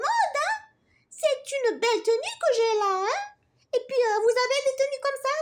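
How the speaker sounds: high, loud affronted fashion-queen bee; offended bee. Acted. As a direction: defend her outfit, huffy and fast. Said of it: "Zozo vexée". (abeille vexée, voix aiguë, forte et rapide)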